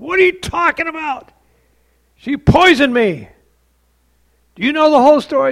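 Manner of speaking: a wronged man shouting back; imitating an enraged husband yelling; furious husband. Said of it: "And he became furious".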